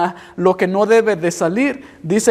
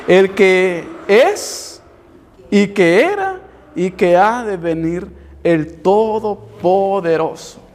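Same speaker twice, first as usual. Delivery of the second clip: slow, solemn reading of the verse; solemn scripture reading; 3 semitones lower, slower than usual. Acted. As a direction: read it slowly and weightily, like holy scripture. Reads scripture aloud slowly. (lectura solemne y pausada de la Escritura)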